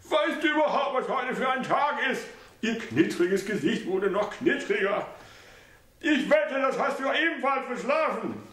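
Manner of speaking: high mother's voice, reproachful; reproachful old mother. A narrator's reading. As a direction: test him with a question.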